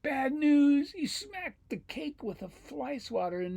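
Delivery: high, loud mousy wail; squeaky storybook dismay; dismayed mouse. Acted. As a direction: complain in a high little mouse voice.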